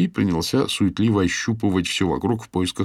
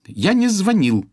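sharp, slow cutting reply; curt; 13 semitones higher, 5 dB louder, slower than usual. A narrator's reading. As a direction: cut him off sharply. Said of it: "отрезала Ирис".

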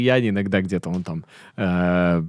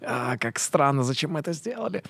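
muttering dismay; 9 dB quieter than usual. Retold or imitated. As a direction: mumble it backstage in despair. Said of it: "ты стоишь за кулисами и такой".

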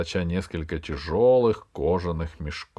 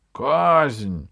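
loud, raised call with falling end; demanding cry; 6 semitones higher, 6 dB louder than usual. A narrator's reading. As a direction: demand it like a priest calling for execution. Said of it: "требовали жрецы". (требовательный выкрик)

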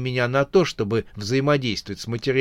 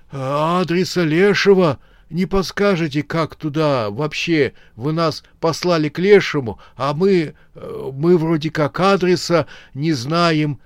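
higher, stammering fright; terrified; 6 semitones higher, 4 dB louder than usual. Acted. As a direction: mumble in mortal fear. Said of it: "Поражённый смертельным страхом".

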